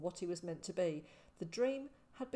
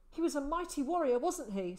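fast, higher and louder with eager hope; eager pride; 7 semitones higher, 5 dB louder than usual. A narrator's reading. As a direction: press for confirmation, beaming. Boy eagerly praising father again.

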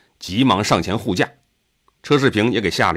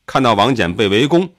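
usual speaking voice

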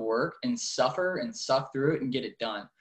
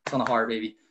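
ordinary talk

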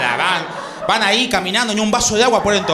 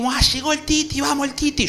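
put-on greeting a toddler; 6 semitones higher than usual. Uncle greeting little nephew.

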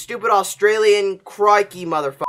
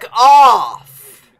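loud, raised furious yell; angry shout; 6 semitones higher, 10 dB louder than usual. Real, not acted. Gamer telling the game off, angry shout. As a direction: yell it off in real rage. Gamer raging after a fall.

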